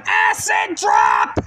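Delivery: drawn-out call, pitched high. Acted. Calling the finisher.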